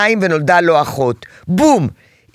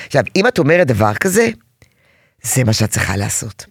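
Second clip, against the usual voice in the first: normal talking voice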